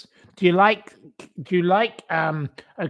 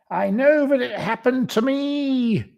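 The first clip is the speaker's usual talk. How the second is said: mock victim; 9 semitones higher than usual. Mocking her claim to viewers, high and whiny. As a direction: plead it in a put-on wounded voice.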